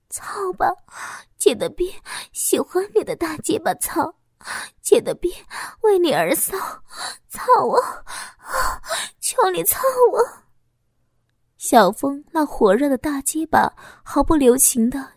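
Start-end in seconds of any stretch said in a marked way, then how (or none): 0.1-10.2 high, wanton begging in short bursts; lustful pleading. A narrator's reading. Woman to her adult lover in bed, lustful high pleading. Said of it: "淫荡地说着".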